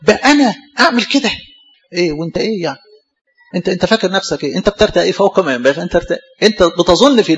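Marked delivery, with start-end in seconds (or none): 0.0-1.3 can't believe he did it; a proud sinner shocked at his own fall; shocked disbelief. Retold or imitated. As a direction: voice jumps high, incredulous. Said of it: "في واحد بيستغرب".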